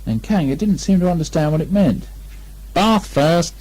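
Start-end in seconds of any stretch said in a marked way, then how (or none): 2.7-3.5 cheerful. Acted. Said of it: "in a cheerful voice".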